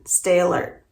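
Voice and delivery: ordinary delivery, nothing marked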